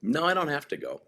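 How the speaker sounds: high, loud insistent no; emphatic denial. Retold or imitated. Answering a friend about the Saudi trip, insistent. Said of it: "And I go".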